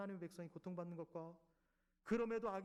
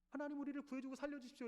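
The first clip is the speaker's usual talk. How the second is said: higher begging plea; pleading cry; 8 semitones higher than usual. Acted. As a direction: cry out to be saved. People's plea to God.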